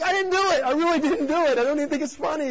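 high-pitched, frantic protest of innocence; hammed-up defensive panic; panicked denial. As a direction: squeal it out as a guilty prankster. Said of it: "I'm like".

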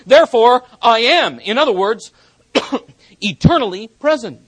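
loud, high, driving sermon voice; full-force preaching. Acted. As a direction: preach it with fire to the congregation.

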